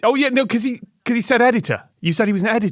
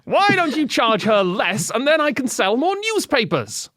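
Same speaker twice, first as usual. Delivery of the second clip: higher put-on salesman voice; Melville character accent; 5 semitones higher than usual. Salesman pitching his scheme to the shopkeeper, hammed-up put-on accent. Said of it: "this is the only accent I can do".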